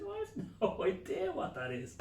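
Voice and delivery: high, laughing disbelief. Retold or imitated. Husband answers wife, high laughing. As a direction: giggle through it.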